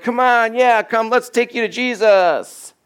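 high, gushing pretend welcome; mock-eager cheering. Preacher imagines a crowd welcoming the blind men, mock-eager. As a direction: cheer them along with exaggerated eagerness.

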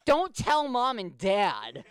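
incredulous. Retold or imitated. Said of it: "And I'm like".

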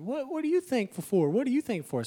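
high singsong host voice asking round the table; mock host. Pastor mimicking the table host, singsong. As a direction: ham up the family ritual. Mocking Thanksgiving table ritual.

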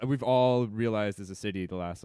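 ordinary delivery

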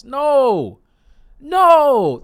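high, loud, flat-out refusal; emphatic no. Real, not acted. To viewers, rejecting defeatist thinking: high, loud emphatic no. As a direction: shut the idea down hard.

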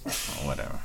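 whispered dismissive whatever; muttered whatever. Real, not acted.

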